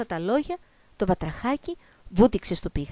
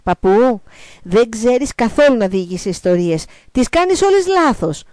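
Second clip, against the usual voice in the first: exasperated grandchild; 3 semitones higher, 8 dB louder than usual. Acted. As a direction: tell grandpa off loudly. Grandchild scolds grandfather in story. (φωναχτή, αγανακτισμένη επίπληξη)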